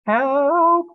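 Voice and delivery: loud high cry for help; scream. Acted. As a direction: scream as the machine drops. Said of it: "Adam screamed".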